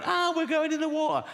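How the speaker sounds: high-pitched mock panic; panicked crowd. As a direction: cry out as a panicking passenger in a comic retelling. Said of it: "people are running around going".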